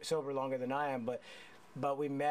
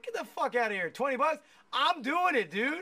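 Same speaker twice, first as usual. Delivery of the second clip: higher, louder mock-outraged retort; incredulous outburst; 9 semitones higher, 4 dB louder than usual. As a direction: fire it back at the kid in disbelief. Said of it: "I'm like".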